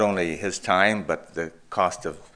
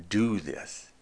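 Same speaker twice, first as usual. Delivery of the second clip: "Do" held and stressed, falling onto "this"; 2 semitones higher, 4 dB quieter than usual. Shown as a demonstration. Preacher contrasting doing with believing, stress on "Do". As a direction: set "Do" apart as the word that counts. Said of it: "all the emphasis on the word do".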